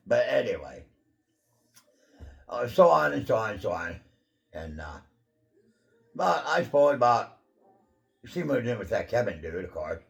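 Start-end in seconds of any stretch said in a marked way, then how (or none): none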